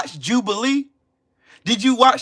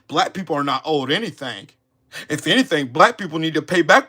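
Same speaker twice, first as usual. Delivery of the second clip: mock quote of a YouTuber; 7 semitones lower than usual. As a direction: quote him in a flat put-on voice. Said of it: "Who said that?".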